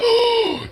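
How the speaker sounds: sharp, high, theatrical gasp; mock gasp. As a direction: gasp in fake horror. Shocked at friend's verdict.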